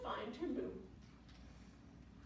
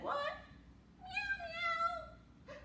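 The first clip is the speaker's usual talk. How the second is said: tiny high-pitched cat mews; kitty meow. A narrator's reading. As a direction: mew like a little kitten. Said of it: "the little kitty said".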